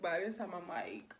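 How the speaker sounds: usual speaking voice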